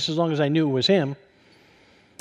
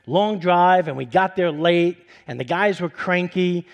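ordinary talk, nothing marked

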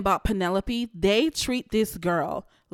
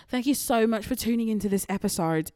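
put-on British accent. Host thanking listeners in a put-on British accent. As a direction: thank them like a cheeky Brit. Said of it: "And this is my British".

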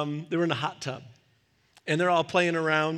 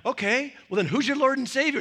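higher, louder mock pastor question; playful kid preacher; 8 semitones higher, 6 dB louder than usual. Avery asking the younger kid his faith, playful mock pastor. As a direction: quiz him like a pretend pastor. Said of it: "Avery went like".

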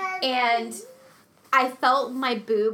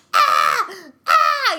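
mock baby screams; 8 semitones higher, 7 dB louder than usual. Mom imitating her screaming newborn. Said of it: "screaming bloody murder".

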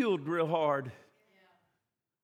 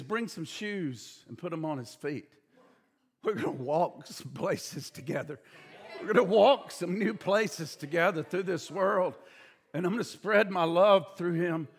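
much softer, lower tender words; tender father; 6 semitones lower, 7 dB quieter than usual. Acted. Father tells servants about walking together, soft and tender. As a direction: speak it gently, like a promise.

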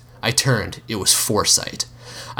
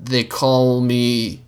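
hesitant; 3 semitones higher, much slower than usual. Acted. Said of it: "I hesitated".

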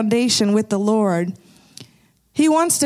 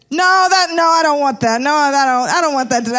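mock insistent refusal, higher at first; 4 semitones higher, 4 dB louder than usual. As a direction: imitate someone hurriedly waving off an offer. Retold man refusing prayer offer.

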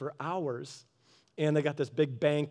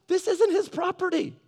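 exasperated correction; 13 semitones higher, 3 dB louder than usual. Retold or imitated. Correcting the worker.

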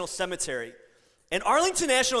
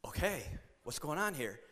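softer, puzzled under the breath; quietly taken aback, half to himself; wary hushed aside; 6 semitones lower, 10 dB quieter than usual. Retold or imitated. Confronted by an irate man after speaking, hushed. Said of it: "I'm like".

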